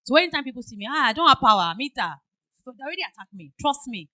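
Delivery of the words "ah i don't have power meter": loud, complaining customer, imitated; angry customer. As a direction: bark out the complaint like an irate caller.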